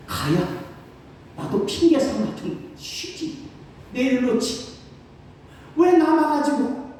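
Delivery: high, slow mock grumble about the people; whiny high mock complaint; grudging inner grumble. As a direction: sulk it out in a high voice. Pastor voicing his grudging thoughts.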